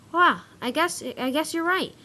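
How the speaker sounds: high-pitched, lifted storybook delivery; acted dawning agreement, voice jumping up; brightening realization. A narrator's reading. Arthur coming round after his friends' praise, cheered up.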